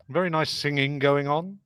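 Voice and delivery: ordinary talk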